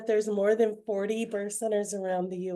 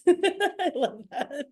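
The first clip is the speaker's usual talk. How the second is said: high-pitched words through a laugh; 6 semitones higher, much slower than usual. Real, not acted. Amused panelist opening her introduction, laughing. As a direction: let a laugh carry the words. Laughs at own superpower remark.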